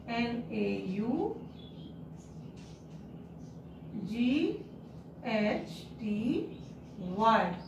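naughty spelt letter by letter, slow and soft; spelling out letters. Shown as a demonstration. Teacher spelling naughty for children, letter by letter. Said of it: "spelling naughty for pupils".

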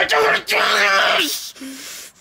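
tearful refusal. Real, not acted. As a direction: beg through tears to stop. Distressed refusal on camera.

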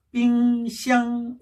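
"삥샹" slow, two level held notes; double first tone. Shown as a demonstration. Teacher drilling fridge for students, "삥샹" slow level tones. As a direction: stretch "삥" and "샹" without moving pitch. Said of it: "냉장고 다시 한번 해볼까요".

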